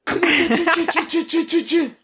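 high squeaky laugh. Real, not acted. Silly duck bit.

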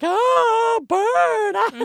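put-on high wailing little-girl voice; mock child crying. Mother imitating her daughter's high wailing cry. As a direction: wail it the way a burnt toddler cries. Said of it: "after the crying of".

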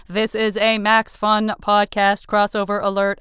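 stiff robot voice announcement. Pretend. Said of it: "I did that weird robot voice".

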